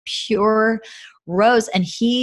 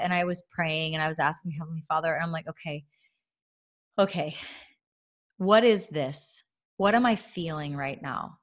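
hushed prayer; 3 semitones lower, 7 dB quieter than usual. Retold or imitated. Retold quiet prayer to God.

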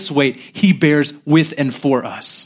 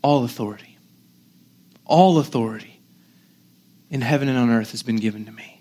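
soft, lower, with long pauses; gentle, compassionate; 4 semitones lower, 4 dB quieter than usual. Acted. Preacher as Jesus to weak, doubting disciples, gentle and compassionate. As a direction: lean in and say it meekly, hushed. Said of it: "he's gentle, he's compassionate".

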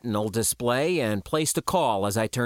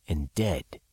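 usual speaking voice

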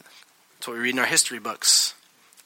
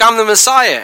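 higher and louder, a cocky boast; proud Messiah claim; 7 semitones higher, 9 dB louder than usual. Acted. Jesus tells his church friends, higher and louder boast. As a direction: announce it like a proud kid.

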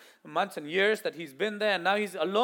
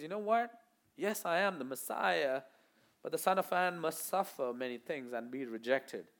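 softer, lower, sober reply; calmly deflate the excitement; bringing him down; 4 semitones lower, 8 dB quieter than usual. Retold or imitated. Jesus quietly sobering Peter after his declaration, soft. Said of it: "Jesus just kind of brings him right down".

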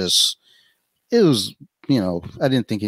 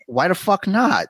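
high indignant disbelief; 11 semitones higher than usual. Retold or imitated. Retold disbelief.

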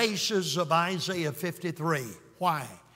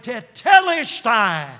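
slow, much louder proclamation of Tetelestai; triumphant cry; 3 semitones higher, 10 dB louder, slower than usual. Retold or imitated. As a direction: shout Tetelestai in victory. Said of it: "he cried the most triumphant word".

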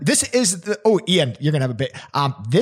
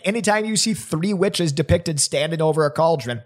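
normal talking voice

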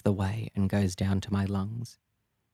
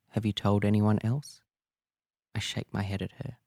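ordinary delivery, nothing marked

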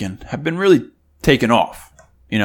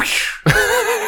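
high squeaky laugh. Retold or imitated. Retelling Kevin's reaction.

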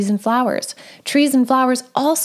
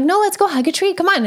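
higher, brushing off her doubt, upbeat; playful insisting; 7 semitones higher, faster than usual. Retold or imitated. Mom playfully insisting her daughter hug a tree, higher. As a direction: cheerfully overrule the "that's silly".

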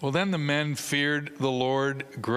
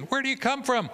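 eager reporter shout; 12 semitones higher, faster than usual. Acted.

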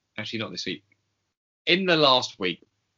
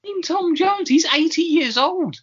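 much higher, louder put-on outrage; mock indignant; 5 dB louder than usual. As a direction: protest in a squeaky hammed-up voice.

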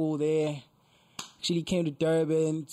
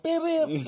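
car horn beep; 13 semitones higher, 5 dB louder than usual. Retold or imitated. Imitating taxi horn.

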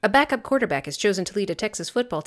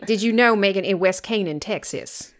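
put-on West Texas accent. Telling co-host about West Canaan, mock Texas accent. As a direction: put on a bad small-town Texas accent. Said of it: "do a West Texas accent".

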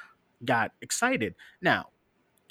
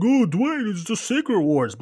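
mocking fanboy; 8 semitones higher, 6 dB louder than usual. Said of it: "the hardcores and the diehards are like".

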